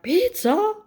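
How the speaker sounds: high delighted cry of pizza; excited squeal. Retold or imitated. Kids finding pizza in the freezer, excited squeal. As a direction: squeal it like a thrilled kid. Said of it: "they're like".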